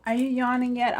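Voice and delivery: ordinary delivery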